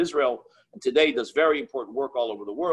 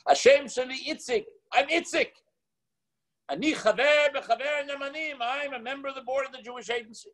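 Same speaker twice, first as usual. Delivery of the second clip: the classic Israeli guy, pitched up, introducing himself; Israeli accent; 10 semitones higher than usual. Retold or imitated. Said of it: "Classic Israeli guy".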